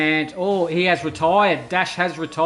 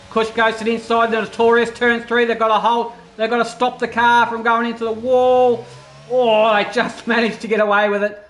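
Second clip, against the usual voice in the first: higher, urgent play-by-play; excited race call; 4 semitones higher, 4 dB louder than usual. Real, not acted. Commentator watches cars nearly hit the wall, excited. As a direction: build suspense through the corner, then relief. Calling a near crash live.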